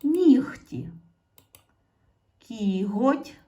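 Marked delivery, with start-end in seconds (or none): 0.0-0.9 "нігті" said high and loud; emphatic teacher "нігті"; loud model word. Shown as a demonstration. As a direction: call out "нігті" higher and louder. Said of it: "Пишемо".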